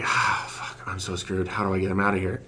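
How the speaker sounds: whispered, soft despair; hushed dismay. Retold or imitated. GoPro nearly lost, a hushed defeated whisper. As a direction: mutter it under your breath.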